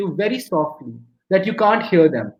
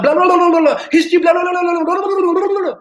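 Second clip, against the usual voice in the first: high-pitched rapid-fire gabble; far too fast; 9 semitones higher, 4 dB louder, faster than usual. Retold or imitated. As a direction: imitate a teacher racing through the lesson as a blur. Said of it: "वो इतनी तेज पढ़ाता था".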